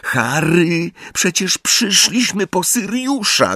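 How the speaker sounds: high, strained plea; piping, overwrought protest; squeaky, tense cry. A narrator's reading. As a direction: cry out in a tense squeak, without much volume. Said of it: "krzyknęła piskliwym, napiętym głosem".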